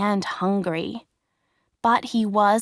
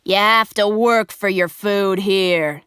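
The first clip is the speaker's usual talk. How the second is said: firm, louder working men; gruff workmen; 4 dB louder than usual. A narrator's reading. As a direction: tell the hungry puppet he must earn it. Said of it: "they said".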